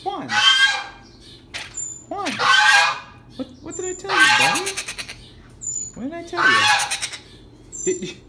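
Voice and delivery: very high, loud squeaky telling-off of a pet; mock scolding. Real, not acted. Scolding the pet bird Juan.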